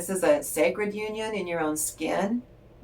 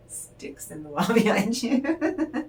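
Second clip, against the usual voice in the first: said slowly through giggles; amused at the card's hidden swords, laughing; 4 semitones higher, slower than usual. Real, not acted. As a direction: let the laugh spill into the words.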